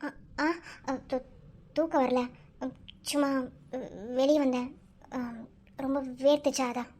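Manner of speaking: low, halting, broken-up excuse; flustered stammering. Acted. Caught outside at night by a colleague, flustered stammering. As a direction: stumble over your words, fishing for an excuse.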